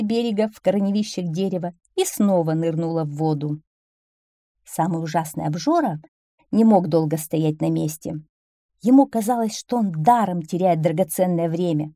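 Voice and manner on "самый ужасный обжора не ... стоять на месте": usual speaking voice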